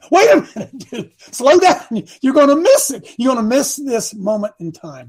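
high, loud urgent call; the Lord's voice. Retold or imitated. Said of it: "He said".

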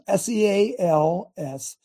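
S E A L S, letter by letter, slowly; spelling it out. Shown as a demonstration. Preacher to producer Spencer, spelling slowly.

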